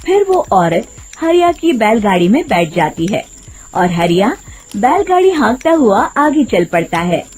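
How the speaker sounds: ordinary delivery, nothing marked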